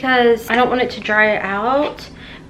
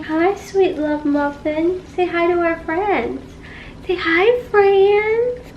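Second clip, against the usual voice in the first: lightly higher, softer cooing at the cat; pet talk; 5 semitones higher, 3 dB quieter, slower than usual. Real, not acted. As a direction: coax the cat sweetly.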